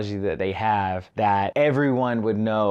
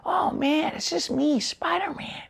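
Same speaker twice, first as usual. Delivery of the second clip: squeaky mock falsetto; Spider-Man. Reviewer mimics the actor's off accent, squeaky falsetto. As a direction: send up a boyish hero, very high. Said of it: "talking like this".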